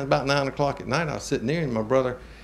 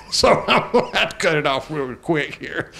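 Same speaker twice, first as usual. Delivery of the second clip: choked laugh; 5 semitones higher than usual. Real, not acted. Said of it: "I get broke up when I start talking about this".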